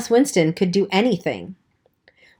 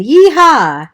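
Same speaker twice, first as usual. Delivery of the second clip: high, loud cowboy whoop; yee-haw yell; 8 semitones higher, 8 dB louder than usual. Acted. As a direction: whoop it like a rowdy drunk cowboy. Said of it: "someone yelled".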